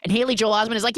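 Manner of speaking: ordinary talk, nothing marked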